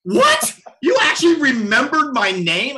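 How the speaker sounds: high, loud, disbelieving cry; astonished. Retold or imitated. Stunned reply to famous conductor.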